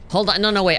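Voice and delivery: usual speaking voice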